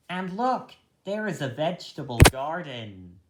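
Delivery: ordinary delivery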